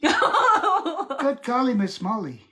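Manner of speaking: drawn-out high British exclamation; slow, playful mock British accent. Shown as a demonstration. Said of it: "I can do British accents".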